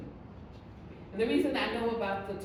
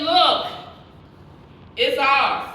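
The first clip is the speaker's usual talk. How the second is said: much louder triumphant claim; triumphant shout; 11 dB louder, much slower than usual. Retold or imitated. Joshua telling Moses about the land, loud triumph. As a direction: proclaim victory to the crowd. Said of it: "came back and said".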